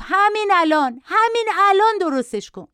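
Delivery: put-on high, insistent little-boy demand; yelling child. A narrator's reading. Boy demanding his mother fix it at once, yelling. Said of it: "بچه با فریاد گفت".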